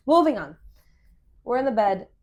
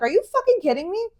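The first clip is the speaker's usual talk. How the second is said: loud, high retold disbelief; indignant squeal of a question; incredulous; 10 semitones higher, 6 dB louder than usual. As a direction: fling it at him, pitch soaring. Retold incredulous comeback to boyfriend.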